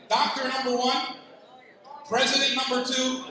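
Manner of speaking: ordinary delivery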